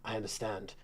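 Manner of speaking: very soft, low acknowledgement; hushed reply. Acted. Guard answering a secret warning, very hushed. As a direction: drop almost to a whisper. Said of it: "He kind of whispers".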